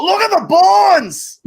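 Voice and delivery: high, slow, shrieking "look!"; excited shout. Retold or imitated. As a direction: point and yell in wonder. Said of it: "you just point and go".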